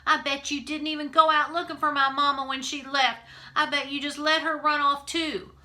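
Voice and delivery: 높고 크게 쏟아내는 분노의 고함; 엄마 일로 아빠를 탓하며 소리치는 딸; angry shout. A narrator's reading. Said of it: "I shouted".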